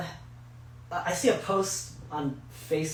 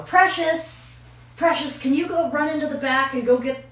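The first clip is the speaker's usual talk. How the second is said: high, loud imitation of a cashier calling; raised, higher put-on voice; 10 semitones higher, 6 dB louder than usual. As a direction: call across the store for your helper. Cashier calls bagger for an errand.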